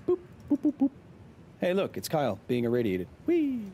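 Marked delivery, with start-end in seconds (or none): 0.1-0.9 short high-pitched boop noises; playful little squeaky boops. Pretend. Silly noises to attract chat. 3.2-3.6 thin, high playful "whee". Pretend. Playful noise for irradiated character.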